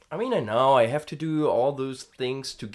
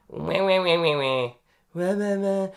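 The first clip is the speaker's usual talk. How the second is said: vocal fry wahs; 6 semitones higher, 5 dB louder than usual. Shown as a demonstration. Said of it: "now the funny thing again".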